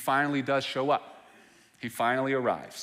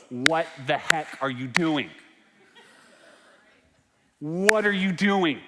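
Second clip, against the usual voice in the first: mock exasperation; 3 semitones higher, 4 dB louder than usual. Voicing a believer confronting God.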